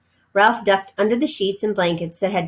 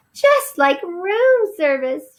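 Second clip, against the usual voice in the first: high, slow, amazed; marveling mother mouse; 11 semitones higher, 3 dB louder, much slower than usual. Acted. Mother mouse marvels at the sandwich, high and slow. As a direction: gush it out in wonder. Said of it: "she marveled".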